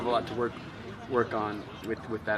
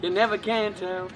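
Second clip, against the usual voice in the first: high, amused echo of a game line; quoted catchphrase; 8 semitones higher than usual. Retold or imitated. Echoing a friend's favourite quote, playful higher-pitched imitation. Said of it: "one of my favorite quotes".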